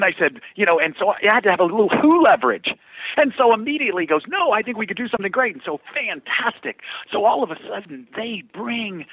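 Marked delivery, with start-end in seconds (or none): 4.3-5.5 quick, raised, louder retold reply; suddenly eager. Agent won over by Jimmy Johnson connection, suddenly eager. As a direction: imitate an agent jumping at the chance.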